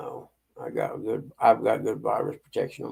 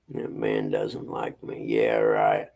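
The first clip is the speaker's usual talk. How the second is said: much lower, slow teasing voice; low mocking voice; 14 semitones lower, slower than usual. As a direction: drop way down to mock him. Mock voice teasing chat members.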